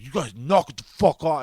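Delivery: mock a fed-up guy telling people off; pissed-off guy. Concertgoer to crowd surfers, pissed off. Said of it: "all fucking pissed about it".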